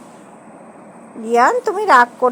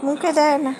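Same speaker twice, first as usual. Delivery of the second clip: gentle reminder; 5 dB quieter than usual. Real, not acted. First gentle telling-off of child. (নরম গলায় বারণ)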